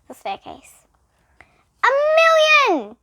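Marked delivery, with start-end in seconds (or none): 1.8-3.0 triumphant shout. A narrator's reading. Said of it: "he shouted triumphantly".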